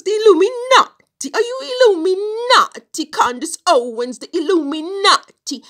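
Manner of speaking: very high, loud mock Italian outcry; Italian accent. Student asking Candace about the Illuminati, heavy Italian accent. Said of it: "he has this heavy Italian accent".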